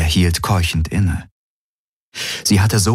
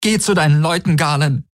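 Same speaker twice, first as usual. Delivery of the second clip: high, hurt rejection; anguished, pitched high; raw, wounded; 10 semitones higher than usual. A narrator's reading. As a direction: throw it back from an open wound. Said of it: "Ihre Stimme war wie eine rohe Wunde".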